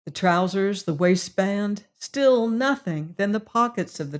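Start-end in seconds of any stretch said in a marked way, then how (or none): none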